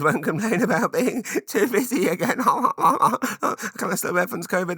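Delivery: real laughter breaking through; laughing. Real, not acted. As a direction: laugh at your own awkward answer. Laughing at his own joke.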